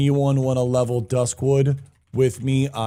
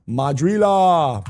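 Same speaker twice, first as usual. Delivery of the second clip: dramatic name call; 4 semitones higher, 5 dB louder, slower than usual. Real, not acted.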